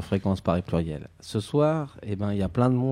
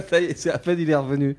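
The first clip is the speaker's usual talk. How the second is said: laughing; 6 semitones higher than usual. Real, not acted. Laughing at the duck gag.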